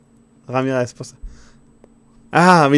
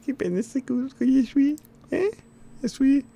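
odd character voice; 12 semitones higher, 3 dB louder than usual. Acted. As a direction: keep the strange figure going, pitched up. Voicing the character.

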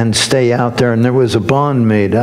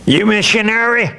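imitating an angry local, pitched up; a sharp demanding question, mock angry; retold angry challenge; 8 semitones higher than usual. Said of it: "in a very angry voice".

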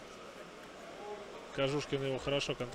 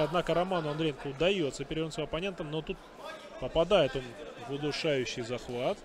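normal talking voice

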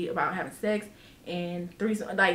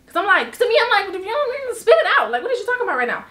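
exasperated demand; 9 semitones higher, 3 dB louder, faster than usual. Retold or imitated. Retold demand to spit it out.

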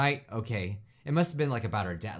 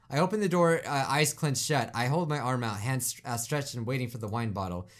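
low, measured scene-reading; subdued first-person storytelling; story narration; 5 semitones lower, 4 dB quieter than usual. A narrator's reading. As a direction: narrate quietly and evenly, pitch sinking. Reads game narration aloud.

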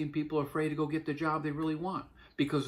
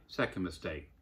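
ordinary talk

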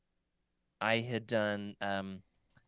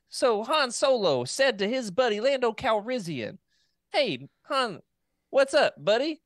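high, slow, louder hammed-up street accent; imitating the AI's street accent, much higher and slower; mock street accent; 10 semitones higher, 6 dB louder than usual. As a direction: ham up the street accent like a goofy AI narrator. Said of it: "kind of a street accent".

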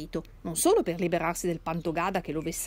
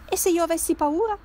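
put-on raised pitch, a little louder, a worried young woman objecting; anxious objection; 10 semitones higher, 5 dB louder than usual. A narrator's reading. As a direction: voice the daughter's timid fear, pitched up. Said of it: "obiettò Adriana". (obiezione timorosa, voce più acuta)

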